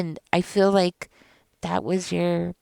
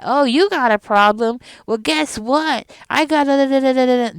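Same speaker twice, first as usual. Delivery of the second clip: mocking dismissive parent; 5 semitones higher, 6 dB louder than usual. Mocking the narcissist's brush-off reply.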